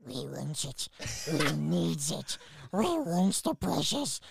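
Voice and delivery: slow, strange creature voice; slow, otherworldly Gollum rasp; Gollum impression. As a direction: crave the precious like Gollum. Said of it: "If Gollum".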